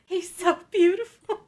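high, crying whine redone; pathetic whimper. Retold or imitated. Reenacting it for her friend, hammed-up pathetic whine. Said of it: "the way you said it".

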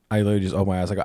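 normal talking voice

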